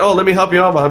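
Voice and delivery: eager offer. Retold or imitated. Son offering to help mom carry a crib, eager.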